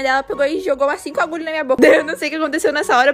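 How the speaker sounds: ordinary talk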